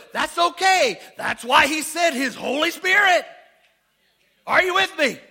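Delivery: shouted, ringing sermon lines; preaching at full force. Acted. Pastor to congregation, full-force shouted preaching. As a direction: preach it out loud and high to the crowd.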